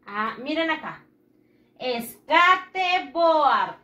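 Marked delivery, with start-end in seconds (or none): 1.8-3.8 SKATEBOARD sounded out letter by letter as Spanish, "es-ka-te-bo-ard", slow and loud; spelled-out Spanish reading. Shown as a demonstration. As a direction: stretch SKATEBOARD into Spanish syllables with every vowel sounded. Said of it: "Se escribe así".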